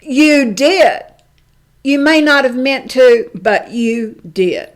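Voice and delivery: loud, firm, the last words slowed; firm angry retort. Retold or imitated. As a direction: make him hear it. Angry reply to journalist.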